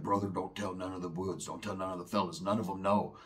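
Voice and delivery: low, hushed plea not to tell. Retold or imitated. Roach begging his homeboy to keep it quiet, hushed. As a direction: keep it down, keep the secret.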